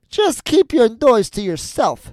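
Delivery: high, loud, slow witch rebuke; scolding old witch. A narrator's reading. Witch silences the lamenting Gretel, loud scolding. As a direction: shout the girl down, drawing out the words. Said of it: "said the old woman".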